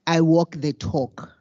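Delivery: usual speaking voice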